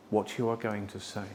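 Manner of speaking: ordinary talk, nothing marked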